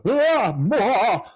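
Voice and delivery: loud, high sputtering blurt; flustered blurt. Retold or imitated. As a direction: sputter out nonsense in panic. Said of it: "He goes, uh".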